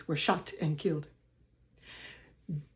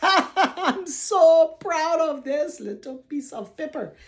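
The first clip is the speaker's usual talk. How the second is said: laughing; 6 semitones higher, slower than usual. Real, not acted. Laughing pride over certificate.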